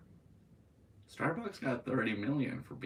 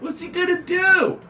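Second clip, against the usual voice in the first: very high, very loud incredulous yell; outraged shout; 15 dB louder than usual. Real, not acted. Ranting about an eleven-year-old being shot, outraged shout. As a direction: shout it in real disbelief. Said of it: "I startled my dog".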